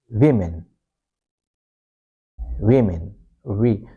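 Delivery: "women" as WIM-in; short I. Shown as a demonstration. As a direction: say "women" with short I sounds, stress first. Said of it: "short I sound".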